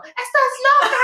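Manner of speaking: high mock yell; retold shout. Seller runs off yelling at the tourist, high mock shout. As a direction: imitate someone yelling while running off. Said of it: "sale corriendo y gritando".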